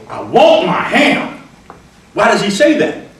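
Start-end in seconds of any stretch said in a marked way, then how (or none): 0.1-1.4 higher, louder, slow insistent plea; Hambone demanding ham. Acted. Hambone demanding his ham, loud and insistent. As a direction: plead for the ham stubbornly. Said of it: "That actor has to say".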